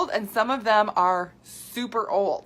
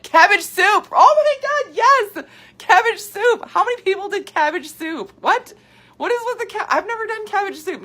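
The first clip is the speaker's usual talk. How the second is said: high, loud, amazed squealing; excited disbelief; 13 semitones higher, 9 dB louder than usual. Real, not acted. Host reading viewers' cabbage soup confessions, loud high disbelief. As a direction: shriek it like you can't believe it. Reading wild diet comments.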